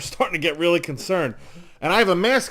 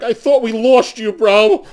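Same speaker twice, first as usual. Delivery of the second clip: almost crying coworker; 5 semitones higher, 8 dB louder, slower than usual. Retold or imitated.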